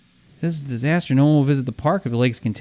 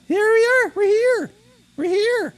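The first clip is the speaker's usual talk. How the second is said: excited squeal; slower than usual. Real, not acted. Arriving at workshop, excited.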